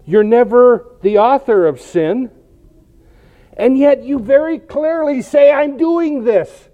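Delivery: high-pitched, insistent challenge to God; incredulous, emphatic appeal; impassioned protest. Acted. Acted protest to God, building.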